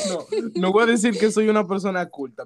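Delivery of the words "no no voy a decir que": laughing. Real, not acted. Laughing while speaking.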